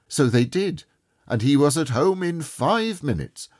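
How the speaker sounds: ordinary delivery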